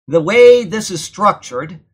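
ordinary delivery

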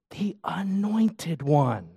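preacher whispering the answer to his congregation; whispered answer. Acted. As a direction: whisper it slowly, like a secret. Whispered answer to own question.

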